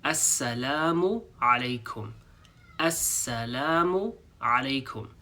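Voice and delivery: "Assalamu alaikum" said slowly, every syllable clear; "Assalamu alaikum" with a long a in salam; slow careful Arabic. Shown as a demonstration. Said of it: "start off first with the greeting itself".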